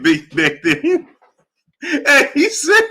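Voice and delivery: words dissolving into high laughter; breaking into laughter. Real, not acted. Host trying to tell Bob's line, overcome by laughter. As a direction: start the quote and crack up. Cracks up before the quote.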